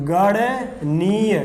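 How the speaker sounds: "गणनीय" stretched out as गण, then नीय; slow syllable split. Shown as a demonstration. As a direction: say "गणनीय" very slowly in two separate parts. Teacher repeats term broken into parts.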